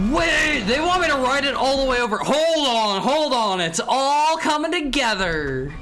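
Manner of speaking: loud, high, rushed game panic; frantic. Real, not acted. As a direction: scramble to keep up.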